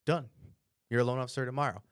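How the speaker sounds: ordinary talk, nothing marked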